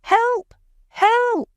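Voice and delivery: very high, loud cry under attack; terrified boy screaming for help; desperate shout. Acted. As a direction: shriek for help as the bats swarm. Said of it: "He makes himself into a ball and shouts".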